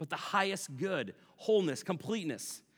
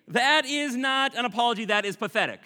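loud, high and scornful; indignant outburst; 8 semitones higher, 9 dB louder than usual. Real, not acted. To the congregation, a loud indignant preacher's outburst. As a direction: blast the fake apology as pathetic. Preacher rejecting a fake apology.

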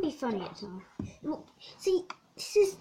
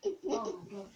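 usual speaking voice

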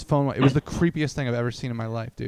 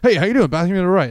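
imitating the usher, higher and louder; rapid-fire greeter; 5 semitones higher, 7 dB louder, faster than usual. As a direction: rattle off the rehearsed greeting. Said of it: "he was talking really fast".

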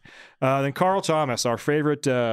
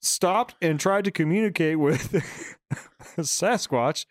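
raised mock newscaster read; news anchor voice; 5 semitones higher than usual. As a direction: announce it like local news. Mock news report after interview.

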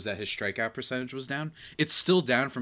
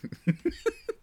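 high burst of laughter. Real, not acted. Laughs at broken screen.

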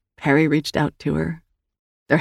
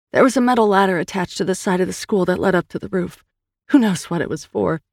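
usual speaking voice

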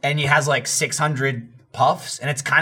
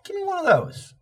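much higher, squeaky craving voice; 14 semitones higher, 4 dB quieter than usual. Retold or imitated. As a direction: squeak it out like a greedy kid. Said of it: "I'm like".

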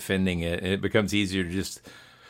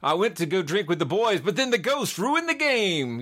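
put-on high, incredulous drunk excuse; mock drinker excuse; 8 semitones higher than usual. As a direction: play a guy blaming the ghost.

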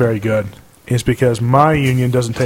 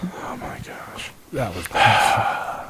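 whispered groan; 5 semitones lower, 9 dB quieter, much slower than usual. Real, not acted. Groaning at a bad joke.

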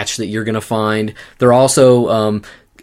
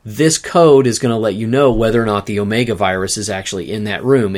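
ordinary talk, nothing marked

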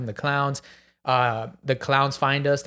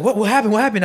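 raised, louder, hurried alarm; alarmed barber; 7 semitones higher, 5 dB louder, faster than usual. Retold or imitated. Barber to his jumpy client, alarmed. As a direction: imitate a startled man demanding to know.

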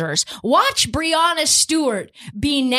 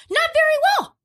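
mock whine; faster than usual. Mocking his own team's loss.

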